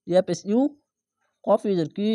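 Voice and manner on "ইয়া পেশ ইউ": ordinary talk, nothing marked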